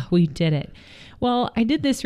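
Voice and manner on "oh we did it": lower, sighed-out relief. Acted. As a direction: let it out on a relieved sigh. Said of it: "breathe that sigh of relief".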